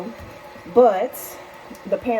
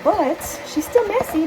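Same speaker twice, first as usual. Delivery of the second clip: much higher, slow and drawn out; fond exasperation; 11 semitones higher, 4 dB louder, slower than usual. Real, not acted. Owner fondly sighing over her messy bird. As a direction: stretch it out fondly. Fond remark, stretched high.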